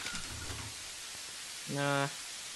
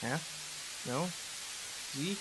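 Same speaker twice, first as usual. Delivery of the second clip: ordinary talk, nothing marked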